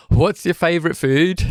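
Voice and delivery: put-on high, jokey Brummy accent. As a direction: put on a Birmingham accent for fun. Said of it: "my Brummy accent shouldn't come out".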